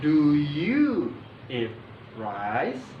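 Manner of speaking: very slow question, YOU drawn out, RICE rising; intonasi bertanya. Shown as a demonstration. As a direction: model the question word by word, lifting "rice?". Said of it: "intonasinya seperti intonasi bertanya". (nada bertanya, sangat pelan, "rice?" naik)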